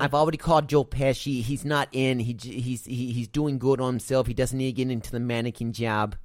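a bad New York accent, keep it going; attempted New York accent. Cohost continuing the New Yorker bit, bad New York accent. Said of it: "Did that sound New Yorkie?".

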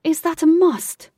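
loud, high, slow exasperated demand; snapping shout. A narrator's reading. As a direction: shout it as if losing patience. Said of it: "Wraith shouted to Alec".

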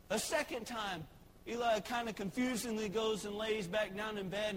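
ordinary talk

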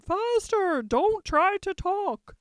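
high, loud imperious cry. A narrator's reading. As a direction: call it out high, slowing on the order. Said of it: "for she cried".

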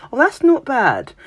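high, lilting "Oh, that's not bad"; faint praise. Brit to someone, polite faint-praise tone. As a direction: sound politely unimpressed. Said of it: "us Brits are terrible for that".

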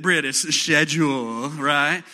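British accent on "schedule", said "shedjool". Shown as a demonstration. The preacher asks everyone to say "schedule" in a British accent. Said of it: "say it like you're Brit".

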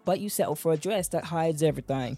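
smooth promo host voice; podcast intro read. Acted. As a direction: sell the show like a trailer voiceover. Podcast intro over music.

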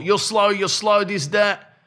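high, sneering schoolyard taunt; mock taunt. Mock taunt mimicking an influencer calling out Usain Bolt. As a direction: jeer it like a show-off calling someone out. Mocking a rival's call-out.

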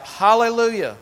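high, loud hallelujah; exultant high shout; cried out. Acted. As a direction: shout praise like the multitude again. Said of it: "Once more they cried out".

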